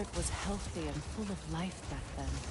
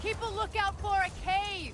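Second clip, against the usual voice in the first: calling out; 5 dB louder than usual. Acted. Calling out while exploring desert.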